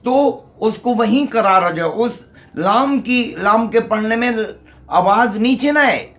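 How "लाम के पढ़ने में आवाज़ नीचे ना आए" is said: ordinary delivery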